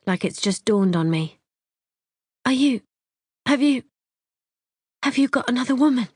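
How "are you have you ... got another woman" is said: higher, stumbling, fast accusation; faked distress. A narrator's reading. Wife stammering an accusation at husband, higher and fast. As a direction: stammer it out as if heartbroken.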